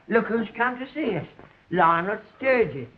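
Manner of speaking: normal talking voice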